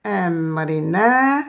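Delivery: "Amrina" stretched very slowly; slow Quranic reading; slow recitation. Shown as a demonstration. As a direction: draw out "Amrina" for the class. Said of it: "ra, che".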